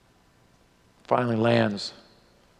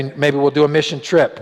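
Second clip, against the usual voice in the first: ordinary talk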